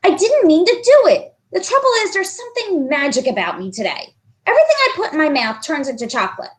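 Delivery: high, rushed protest; protesting boy. Acted. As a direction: protest fast to explain himself. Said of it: "John protested".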